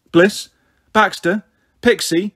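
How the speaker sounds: calling out. A narrator's reading. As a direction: call out for the children one by one. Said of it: "I called".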